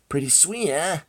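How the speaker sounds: high, cocky character voice; obnoxious voice. Acted. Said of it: "an obnoxious voice calls".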